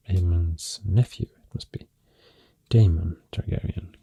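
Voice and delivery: ordinary talk, nothing marked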